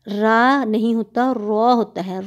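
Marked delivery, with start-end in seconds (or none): 1.3-1.7 रॉ said thick with rounded lips; rounded, heavy रॉ; रॉ from the back, lips round. Shown as a demonstration. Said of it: "रॉ को मोटा करते हैं".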